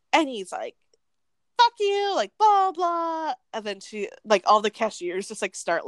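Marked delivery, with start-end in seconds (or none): 1.6-3.3 high-pitched imitation of a tantrum; mock screamed insult. Retelling the customer's parting shot to the cashier, mock screaming. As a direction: caricature an angry outburst, pitched way up.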